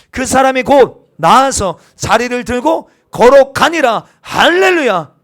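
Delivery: loud, slow preaching; preaching at full force. Acted. Pastor proclaiming the healing to the congregation, loud and slow. Sermon climax.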